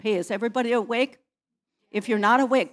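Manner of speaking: calling out. Real, not acted. Pastor wakes sleepy congregation.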